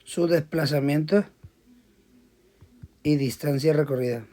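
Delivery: slow, spaced reading of a word problem; teacher dictating a physics problem to students; slow dictation. Shown as a demonstration. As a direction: dictate each phrase with long pauses.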